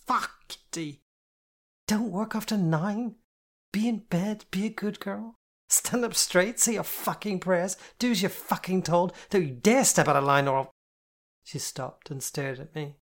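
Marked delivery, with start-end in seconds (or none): 0.1-10.7 angry mimicry. A narrator's reading. Jane mimicking controlling parent.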